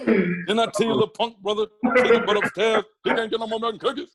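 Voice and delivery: high, loud scolding comedian impression; Bernie Mac. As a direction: scold a little kid in a put-on comic voice. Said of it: "But Bernie was Bernie".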